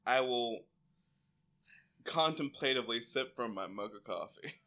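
mock gravitas, low and quiet; pompous Fred. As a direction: say it like a self-serious dad sipping coffee.

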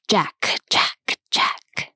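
cold triumphant hiss. A narrator's reading. Spirit hissing in triumph as the man sinks. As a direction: hiss it coldly, victory won. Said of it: "a voice hissing in cold triumph".

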